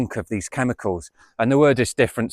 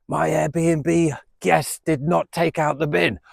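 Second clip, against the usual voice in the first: mock complaining landlady; 5 semitones higher, much slower than usual. Mock phone call to police.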